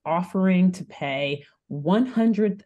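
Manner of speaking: ordinary talk, nothing marked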